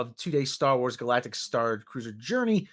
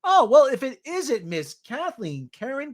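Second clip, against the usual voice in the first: mock surprise; 7 semitones higher, 5 dB louder than usual.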